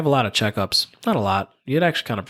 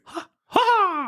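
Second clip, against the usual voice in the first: Mario cry. Retold or imitated. Imitating Mario's game noise, a high cartoon yelp. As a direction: yelp it like a plumber jumping. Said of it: "the only thing Chris Pratt did".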